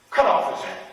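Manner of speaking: loud, high imperious order; royal command. Acted. King overruling the shoemaker, loud command. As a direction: command it with a sudden burst of volume.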